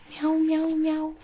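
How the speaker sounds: playful voiced meowing for a baby; mock cat meows. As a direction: meow like a cat to amuse the baby.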